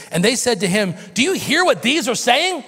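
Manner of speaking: high, loud mocking complaint; a hammed-up outraged Pharisee; indignant religious leaders. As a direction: voice the scandalized leaders.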